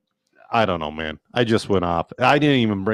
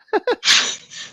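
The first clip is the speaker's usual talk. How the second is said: laugh. Real, not acted. Laughs at own story.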